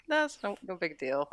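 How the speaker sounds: imitated casual brush-off; offhanded. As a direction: play it down, as if it's nothing. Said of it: "put it in offhandedly".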